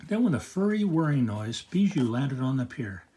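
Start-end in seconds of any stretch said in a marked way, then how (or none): none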